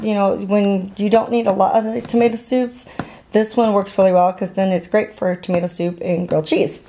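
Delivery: ordinary talk, nothing marked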